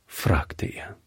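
ordinary delivery

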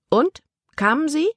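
put-on sharp girl's challenge; sceptical challenge. A narrator's reading. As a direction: dare him to say yes. Libby challenges Caleb.

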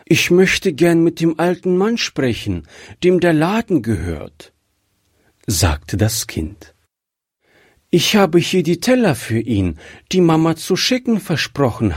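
put-on young child asking politely; little girl voice. A narrator's reading. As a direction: speak up as a shy little girl. Child character Gretchen speaks.

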